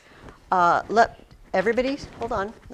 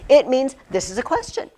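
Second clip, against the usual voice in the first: normal talking voice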